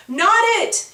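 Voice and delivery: high, loud holler. A narrator's reading. Tegan hollers during tag, loud and high. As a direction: yell it to get out of being it. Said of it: "Tegan hollers".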